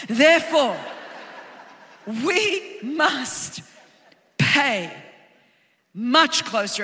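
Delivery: spaced, weighty scripture phrase; slow emphatic reading. Shown as a demonstration. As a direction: stretch Therefore we must pay much closer word by word. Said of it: "Everyone say".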